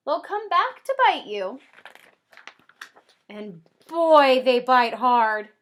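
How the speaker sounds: rhyming read-aloud ending slow and loud; storybook read-aloud. Acted. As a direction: land the punchline for the kids. Reading the book aloud.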